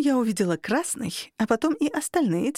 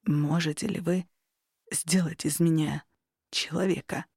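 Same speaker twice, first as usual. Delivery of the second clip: trembling voice; 4 semitones lower than usual. A narrator's reading. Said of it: "он дрожащим голосом произнёс".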